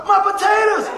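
high, loud wail of dismay; mock horrified cry. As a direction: yell as if your dinner is being stolen. Acted cry over his lost potatoes.

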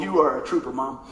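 ordinary talk

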